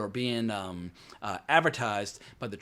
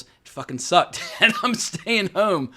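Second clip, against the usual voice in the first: high, loud, drawn-out quote breaking into laughter; slow, loud, laughing gripe; laughing mock complaint; 7 semitones higher, 7 dB louder, slower than usual. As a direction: play a fed-up moviegoer, laughing through it. Acting out a disgusted moviegoer, laughing.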